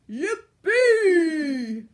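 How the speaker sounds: loud, high whoop of delight; joyful cry. A narrator's reading. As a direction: shout it out like a thrilled giant. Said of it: "he cried".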